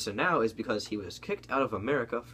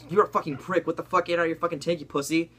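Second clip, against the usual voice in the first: mock yell, a little louder and higher; retold yelling; 7 semitones higher, 3 dB louder than usual. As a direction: imitate angry gamers yelling, pitched up. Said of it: "like, yelling at him, like".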